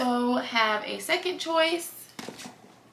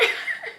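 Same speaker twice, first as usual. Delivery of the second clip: a short high laugh through tears; teary laugh; 4 dB quieter than usual. Real, not acted. Caught off guard by her own tears, teary laugh. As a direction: laugh it off while welling up.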